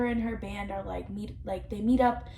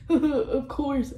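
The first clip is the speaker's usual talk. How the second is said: laughing Ken impression; 4 dB louder than usual. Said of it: "And he's like".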